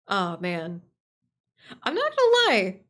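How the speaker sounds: usual speaking voice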